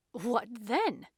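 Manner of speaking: detective caught off guard, echoing in surprise; taken aback. A narrator's reading. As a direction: throw the question back in surprise. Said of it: "The detective seemed taken aback".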